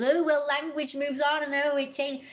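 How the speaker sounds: high mocking whine, lightly sneered; mocking whiny quote. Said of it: "I know everyone says".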